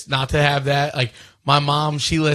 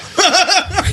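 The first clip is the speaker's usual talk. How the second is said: high squeal laugh; 4 dB louder than usual. Real, not acted. Laughs at Luka joke.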